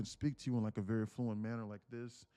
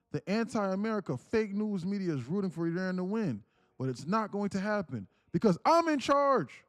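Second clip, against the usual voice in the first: building from raised to near shouting; choppy reading voice; 6 semitones higher, 5 dB louder than usual. Shown as a demonstration. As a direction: chop each phrase, louder and higher toward the end. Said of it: "reading in this choppy voice".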